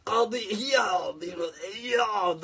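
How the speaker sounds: put-on slow, hammy Arabic accent; drawn-out mock Arabic accent; overdone Arabic accent. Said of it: "overdoing it so much".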